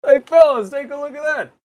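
very high cartoon voice; high silly voice. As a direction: squeal it like a cartoon. Put-on high voice.

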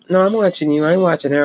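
firm retort. Retold or imitated. Church lady telling the pastor, loud and firm. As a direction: set him straight that she's watching him.

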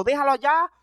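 ordinary talk, nothing marked

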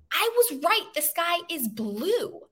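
lightly voiced lion pleading his case; protesting lion. Lion protests to God that he was right, lightly voiced.

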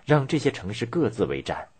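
plain reading voice

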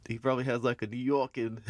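ordinary delivery

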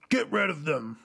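villain voice. Retold or imitated. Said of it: "the whole typical villain".

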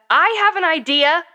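high, loud, drawn-out yell; narrated yell. A narrator's reading. A kid yells over friends who keep ignoring the idea, yelling. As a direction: yell it slowly to be heard. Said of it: "I yelled".

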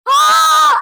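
high, sudden open-mouthed scream; retold scream. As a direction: scream "aah" as you act it out. Screaming as the driver collapses.

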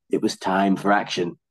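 ordinary delivery, nothing marked

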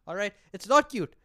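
ordinary talk, nothing marked

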